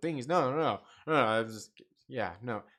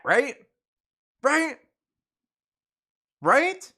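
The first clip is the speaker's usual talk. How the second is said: rising, louder each time; insistent; 12 semitones higher, 7 dB louder, much slower than usual. Real, not acted. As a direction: demand agreement, pushing harder. Building rhetorical challenge.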